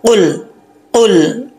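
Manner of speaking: normal talking voice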